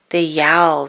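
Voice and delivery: ordinary delivery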